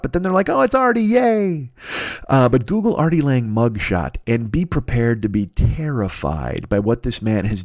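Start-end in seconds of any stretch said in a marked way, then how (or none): 0.5-1.7 high, sing-out fake cheer; mock fan delight. Everybody forgiving Artie for the latest mess, mock fan delight. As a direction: imitate an instantly forgiving fan, pitched up.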